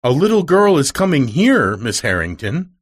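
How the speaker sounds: delighted cry. Acted. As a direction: burst out with happy surprise. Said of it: "cried Nancy".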